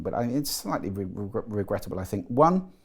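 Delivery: normal talking voice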